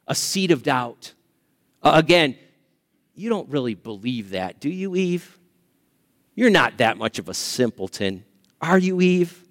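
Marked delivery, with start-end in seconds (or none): 3.1-9.4 low, sly mocking tease; sarcastic serpent. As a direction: needle her with a low sneer. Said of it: "That's the joke".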